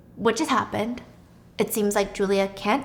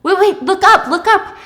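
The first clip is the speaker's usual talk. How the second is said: high, loud excited calling out; 9 semitones higher, 8 dB louder than usual. Retold or imitated. Neighbour points out the woman on the roof, excited call. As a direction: urge them to look up, now.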